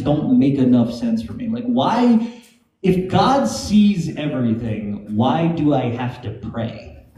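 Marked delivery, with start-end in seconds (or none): none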